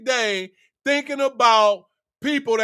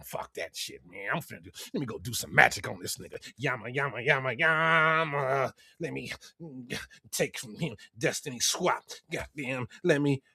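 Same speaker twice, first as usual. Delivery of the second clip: very low, much softer, menacing sorcerer mutter; sinister hater; 9 semitones lower, 8 dB quieter than usual. Retold or imitated. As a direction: plot his dark magic under your breath. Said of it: "He sitting up there just".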